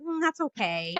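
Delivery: high, awkward retold refusal; polite brush-off. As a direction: wriggle out of it in a high voice. Retold dodging of an unwanted hug.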